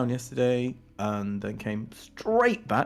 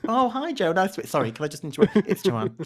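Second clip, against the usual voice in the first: higher, breezy chat then a prim correction; lightly voiced mock Joanne scene; acted-out introduction; 10 semitones higher, 4 dB louder than usual. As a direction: play both sides of the awkward intro. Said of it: "she's like".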